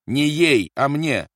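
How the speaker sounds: high, loud retort; irritated correction. A narrator's reading. Officer wants the heart drops himself, irritated correction. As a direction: correct the aide sharply.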